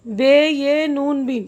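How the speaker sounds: letter by letter, drawn out; measured teacher's spelling demonstration; slow spelling out. Shown as a demonstration. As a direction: spell it slowly, then say the word. Teacher spells the word aloud.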